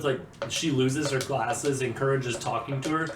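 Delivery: ordinary talk, nothing marked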